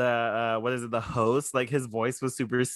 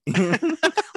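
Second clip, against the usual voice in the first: squealing laugh, high; high burst of laughter; 3 dB louder than usual. Real, not acted. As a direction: let out a high delighted laugh. Laughing at own answer.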